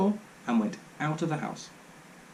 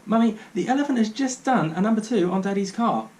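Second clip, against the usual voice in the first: put-on excited child; 6 semitones higher, 3 dB louder than usual. A narrator's reading.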